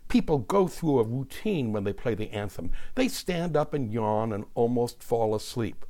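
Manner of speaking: ordinary delivery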